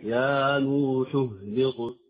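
slow, drawn-out يا نوح اهبط; measured tajweed example on يا نوح اهبط; careful recitation. Shown as a demonstration. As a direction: articulate ح then ه clearly in يا نوح اهبط. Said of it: "فهذه من المواضع الصعبة".

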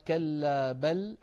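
كلا بل slow, stopping briefly on the lam of بل; sakt demo. Shown as a demonstration. Teacher demonstrating the pause on بل, slow. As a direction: recite كلا بل with a short stop on بل. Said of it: "على لام بل".

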